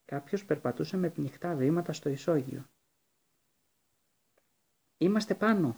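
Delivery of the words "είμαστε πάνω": high, loud call up the stairs; sudden shout. A narrator's reading. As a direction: yell to be found. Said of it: "φώναξε ξαφνικά η Ερμιόνη".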